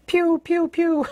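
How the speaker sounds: high, loud pew pew gun sounds; playful cartoon gunfire; gunshot noises. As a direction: fire off mock shots with your mouth. Mimics gunfire after expert claim.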